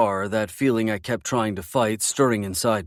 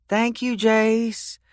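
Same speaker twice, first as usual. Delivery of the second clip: put-on high, slow little-girl drawl; a small girl thanking sweetly; 12 semitones higher, much slower than usual. A narrator's reading. Little girl thanking Jace in her slow drawl. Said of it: "in her little drawl".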